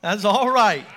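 loud approving church response; congregation call-out. Shown as a demonstration. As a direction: call it out to the preacher. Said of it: "you're like".

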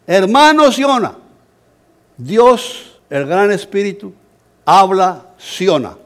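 high, loud, slow proclamation; proclaiming loudly. Retold or imitated. Preacher proclaiming to the villagers in their tongue, loud and slow. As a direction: call it out slowly to the whole crowd.

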